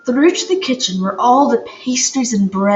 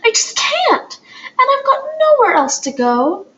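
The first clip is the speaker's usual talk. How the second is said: put-on high, near-tearful girl's voice; mildly trembling, close to tears; trembly voice; 12 semitones higher than usual. A narrator's reading. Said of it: "in a trembly voice".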